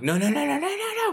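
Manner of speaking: high, loud yelping no's; yelping in pain. Retold or imitated. Injured man yelps as friend lifts him. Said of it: "I scream out, like, I yelp".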